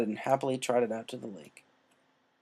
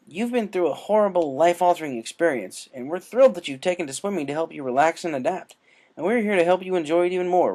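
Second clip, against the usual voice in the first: higher, louder, peppy coach voice; upbeat swim captain; 6 semitones higher, 6 dB louder than usual. A narrator's reading. Swim captain welcomes Rainbow Dash, peppy and loud. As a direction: cheer her on like an eager team captain.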